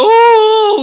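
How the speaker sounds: high, drawn-out weeping "oh"; mock wailing. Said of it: "이러고 우는 거예요".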